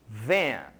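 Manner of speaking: van said the English way, for students to repeat. Shown as a demonstration. Teacher models van for students to repeat, in English pronunciation. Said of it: "Mais uma vez".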